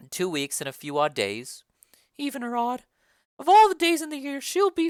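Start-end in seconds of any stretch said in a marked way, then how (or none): 0.1-1.6 low, commanding lady of the house; a noble mother summoning the nurse, lower; Lady Capulet. Acted. Lady Capulet calls the nurse for her daughter, low and commanding.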